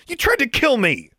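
high, loud furious cry; angry accusation. A narrator's reading. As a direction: hurl the accusation at him. Accusing her attacker.

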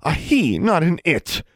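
high, angry, emphatic correction; through clenched teeth. A narrator's reading. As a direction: insist tensely with teeth clenched. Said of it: "said through clenched teeth".

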